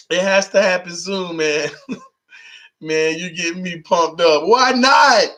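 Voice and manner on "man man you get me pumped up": ordinary delivery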